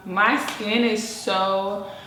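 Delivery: ordinary talk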